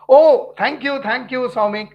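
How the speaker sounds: a loud happy "oh" of real delight; delighted thanks. Real, not acted. Teacher thanking a former student in chat, loud and delighted. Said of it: "Your topology lectures helped me".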